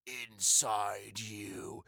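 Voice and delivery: very low, drawn-out, soft monster voice; creature's voice. Acted. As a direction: speak as a slow, menacing creature. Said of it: "And it says".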